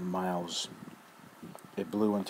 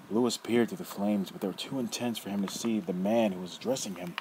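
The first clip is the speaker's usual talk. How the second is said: ordinary talk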